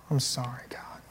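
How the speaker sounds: a hushed, breathy i'm sorry to God; whispered confession. Shown as a demonstration. Pastor models a humble confession prayer, whispered. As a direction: whisper it like a private apology.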